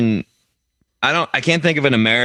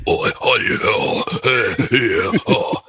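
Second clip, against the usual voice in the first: wordless British oi and eh grunts; mock British bloke grunts; slower than usual. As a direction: grunt like a burly Brit at the pub. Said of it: "the British guys that just go like".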